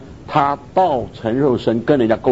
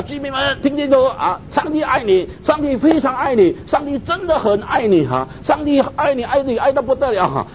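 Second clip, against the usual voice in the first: raised-pitch public announcement, calling out to residents; mock loudspeaker call; 11 semitones higher than usual. Brother on four loudspeakers calling to residents, mock announcer. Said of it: "一直喊，喊，".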